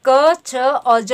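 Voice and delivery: क, छ और ज drawn out very slowly, one at a time; slow letter demo. Shown as a demonstration. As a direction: stretch out क, छ and ज so each sound is clear. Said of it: "ठीक है, तो ऐसे".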